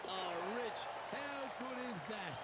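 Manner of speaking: delighted, rising call; excited. Real, not acted. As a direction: cheer the chip-in with glee. Excited reaction to chip-in.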